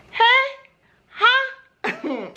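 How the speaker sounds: jolted surprise at the sight; shocked hey. Retold or imitated. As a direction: squeak of disbelief, with a laugh. Sees unpainted toes.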